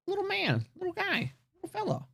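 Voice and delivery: high, soft cooing at a tiny villager; mock baby talk. Troll player cooing at a tiny villager, high and soft.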